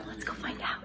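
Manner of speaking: hushed, toneless whisper; whispered aside. Real, not acted. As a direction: whisper it very softly. Whispered aside while filming.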